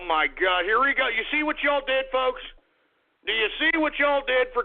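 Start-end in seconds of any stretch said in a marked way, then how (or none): none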